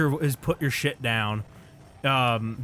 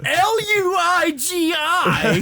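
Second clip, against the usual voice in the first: high, drawn-out indignant shout; mock outrage; 13 semitones higher, slower than usual. Player mocking friend's spelling of Luigi, mock outrage. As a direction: hammer out each letter in mock disbelief. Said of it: "in a way that was like".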